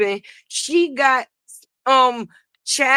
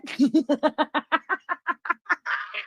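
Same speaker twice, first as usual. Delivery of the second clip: long, trailing chuckle; real laughter, higher in pitch; laughing; 4 semitones higher, 4 dB quieter than usual. Real, not acted. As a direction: crack up at your own joke. Cracks up after accent.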